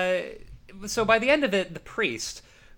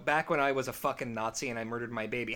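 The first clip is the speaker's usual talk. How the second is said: normal talking voice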